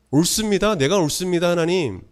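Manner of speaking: high-pitched, defiant protest as Jonah; brazen insistence. Acted. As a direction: insist shamelessly to God that you are right. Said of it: "정말 뻔뻔하죠".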